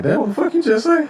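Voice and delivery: high, incredulous question; mock bafflement. Human hearing alien speech, mock bafflement. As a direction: pitch way up in put-on confusion.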